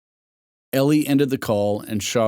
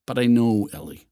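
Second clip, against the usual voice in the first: ordinary delivery, nothing marked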